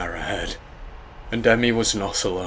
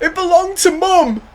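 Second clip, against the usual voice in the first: high, loud grieving wail; 9 dB louder than usual. Acted. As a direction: wail it in grief. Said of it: "he wailed".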